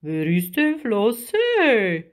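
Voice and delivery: slow, swooping creature question; big drawn-out voice, puzzled; giant octopus. A narrator's reading. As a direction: ask slowly like a sea giant. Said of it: "fragte der Krake".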